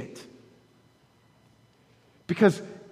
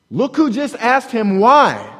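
louder, slower, higher punch; emphatic point; 5 semitones higher, 6 dB louder than usual. Real, not acted. Preacher answering whether anger at God is okay, emphatic. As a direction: drive the point home. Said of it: "emphatic sermon point".

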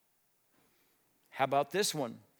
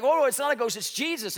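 loud, much higher startled whoa; excited shout; 9 semitones higher, 8 dB louder than usual. Acted. Disciples see it's Jesus on the water, excited shout. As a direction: burst out in amazed relief.

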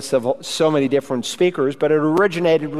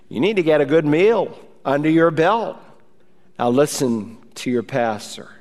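ordinary talk

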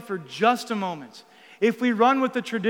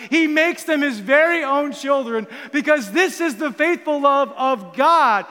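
rising to full-force preaching, louder and higher; 4 semitones higher, 5 dB louder than usual. Acted. Preacher proclaiming God's faithful love to the congregation, building intensity. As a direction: preach it with growing fire.

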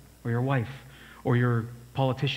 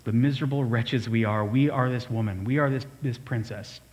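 ordinary talk, nothing marked

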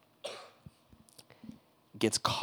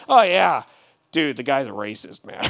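louder offhand "oh yeah"; loud, offhand remark; casual; 3 semitones higher, 9 dB louder than usual. Retold or imitated. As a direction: brush it off like gossip. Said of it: "mock conversation".

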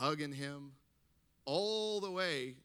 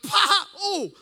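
explosive loud, high snort; burst of laughter; 14 dB louder than usual. Retold or imitated. Laughing while dad is dying, explosive burst. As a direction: let a suppressed laugh blurt out. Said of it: "protocol is to laugh".

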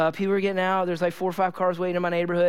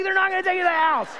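very high, fast mock yelling; exasperated shout; 13 semitones higher, 4 dB louder, faster than usual. Dad to son after missing the stop, exasperated shout. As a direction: act out a dad's incredulous comeback.

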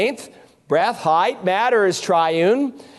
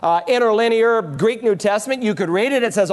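ordinary delivery

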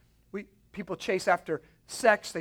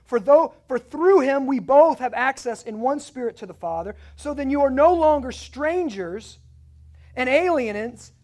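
lightly raised, declaiming Bible reading; scripture reading; 5 semitones higher, 3 dB louder than usual. Acted. Preacher reading Ephesians to his congregation, lightly raised. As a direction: read the passage aloud to the church.

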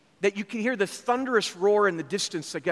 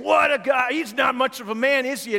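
mocking jeer; 4 semitones higher, 4 dB louder than usual. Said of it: "the guys are laughing about it".

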